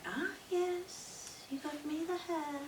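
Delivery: high, drawn-out acted greeting; knowing wise woman. Wise woman at her scrying bowl, knowing. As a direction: voice the old wise woman, pleased.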